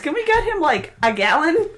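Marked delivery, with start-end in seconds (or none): none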